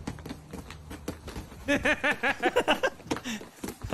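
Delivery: airy burst of laughter; high breathy laugh. Real, not acted. Laughing at the video.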